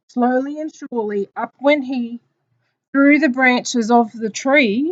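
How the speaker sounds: loud, drawn-out picture-book reading; slow down and build suspense for children; story narration. Acted. Teacher reading of the apple's discovery, slow story narration.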